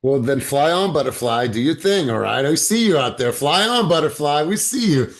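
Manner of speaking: loud, high cheer; excited cheering. Real, not acted. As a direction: cheer her on. Coach cheering participant on.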